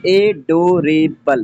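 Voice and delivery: Adorable slowly split into four separate syllables, ए-डो-रे-बल. Shown as a demonstration. Teacher counting four syllables for students, adorable said slowly syllable by syllable. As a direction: break adorable into ए, डो, रे, बल with gaps. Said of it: "four syllable है".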